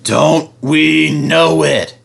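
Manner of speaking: sarcastic slow groan. A narrator's reading. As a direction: drag it out, fed up. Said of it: "they groaned".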